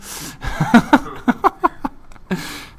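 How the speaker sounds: high burst of laughter; real laugh, mid-story. Real, not acted. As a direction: crack up at your own story.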